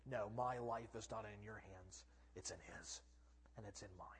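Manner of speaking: very low and soft, fading away; quiet, settled assurance; calm Jesus. Acted. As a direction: let the calm words sink almost to nothing. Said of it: "kind of calmly turns and looks at him".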